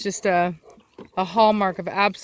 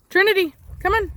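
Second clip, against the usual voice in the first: calling the dog; 10 semitones higher than usual. Real, not acted.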